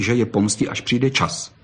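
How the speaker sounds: ordinary delivery, nothing marked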